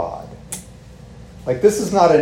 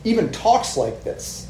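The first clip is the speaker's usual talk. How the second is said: earnest Peter; 5 semitones higher than usual. Retold or imitated. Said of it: "He's like".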